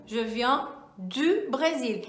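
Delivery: ordinary talk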